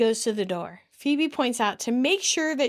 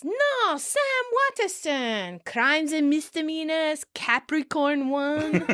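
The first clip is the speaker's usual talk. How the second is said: put-on a lightly done old Italian accent, slowed on the names; 6 semitones higher, slower than usual. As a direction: insist in a mild Italian accent. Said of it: "And Noni insists".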